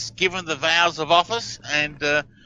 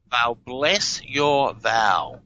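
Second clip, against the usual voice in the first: slow, deliberate reading; 3 semitones lower, much slower than usual. Shown as a demonstration. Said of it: "another profound missile".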